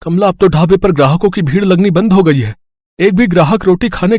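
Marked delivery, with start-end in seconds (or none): none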